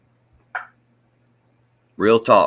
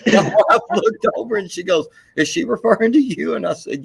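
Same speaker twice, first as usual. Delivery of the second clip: laughing through words; 11 semitones higher than usual. Real, not acted. Laughing while retelling wife's question.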